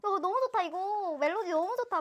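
high, loud re-enacted gushing; imitating herself thrilled by a song; retold excited reaction. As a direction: replay your own fan raving. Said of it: "이러면서 들었는데".

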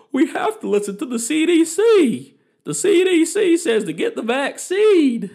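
high, loud mocking parrot of preachy friends; mock liberal friends' accent. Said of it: "why my friends have an accent".

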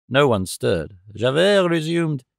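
usual speaking voice

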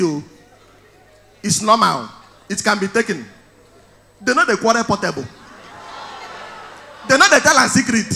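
usual speaking voice

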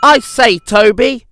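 high, loud indignant outburst; bursting out. A narrator's reading. Percy bursts out to Toby, indignant. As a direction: burst in with urgent news. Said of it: "he burst out".

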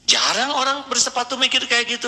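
ordinary talk, nothing marked

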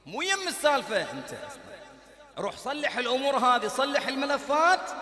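loud, driving sermon cadence; fiery preaching. Acted. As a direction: pound each condition like a verdict. Preacher commanding the listener.

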